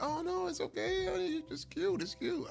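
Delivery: very high, cooing wife voice; imitated soothing wife. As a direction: coo sweetly to placate your husband.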